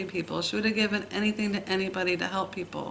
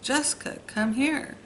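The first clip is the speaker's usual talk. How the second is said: higher calls, insistent and repeated; sister calling again; 7 semitones higher than usual. Retold or imitated. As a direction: call her name up the stairs, higher each time. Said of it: "she'd start calling my name again".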